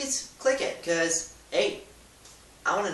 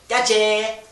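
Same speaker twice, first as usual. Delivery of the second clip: playful gotcha; 7 semitones higher, 10 dB louder than usual. Real, not acted. Pranking the viewer after fake sneeze.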